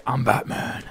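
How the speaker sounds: low, quiet superhero line; Batman impression. Host prompting his friend with a low Batman imitation. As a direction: drop it down like a masked hero. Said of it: "do a Batman impression".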